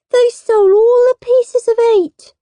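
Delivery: put-on high, loud child's wail. A narrator's reading. Peter complains to his parents, high loud wail. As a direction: wail the loss like an upset little boy. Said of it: "wailed Peter".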